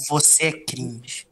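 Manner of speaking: slow punchline. Acted. Punchline of the word challenge.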